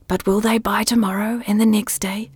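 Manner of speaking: unvoiced whisper. A narrator's reading. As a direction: whisper it, doubting. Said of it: "the voice whispered".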